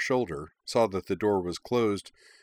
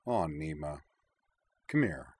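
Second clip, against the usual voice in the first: lower, much softer and tender; softly; 4 semitones lower, 4 dB quieter than usual. A narrator's reading. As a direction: gently draw her in. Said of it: "said softly".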